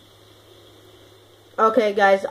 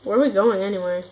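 ordinary talk, nothing marked

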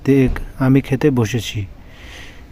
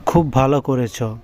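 normal talking voice

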